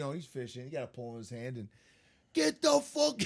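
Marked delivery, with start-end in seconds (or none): 2.3-3.2 high, loud imitation of frantic shouting; high-pitched re-enacted holler; retold yelling. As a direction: yell it in mock panic. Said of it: "He was yelling at me".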